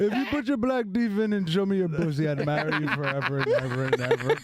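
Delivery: put-on Indian accent, laughing; begging Indian accent. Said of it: "That's very good".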